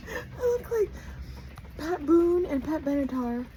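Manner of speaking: high comic squeak; high squeaky voice. Pretend. Joking about her looks.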